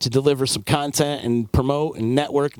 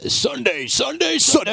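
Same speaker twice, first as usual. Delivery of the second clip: booming high monster truck announcer; 12 semitones higher, slower than usual. Acted. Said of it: "you got the monster truck voice".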